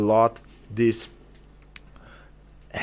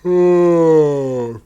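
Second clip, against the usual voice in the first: placement demo ah; 5 semitones higher, 8 dB louder than usual. Shown as a demonstration. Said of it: "find this placement".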